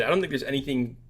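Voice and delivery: ordinary talk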